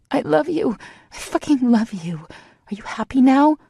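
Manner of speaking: high, loud exasperated confession; exasperated shout. A narrator's reading. Heroine shouting her love at him in exasperation. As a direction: throw the words at him loudly.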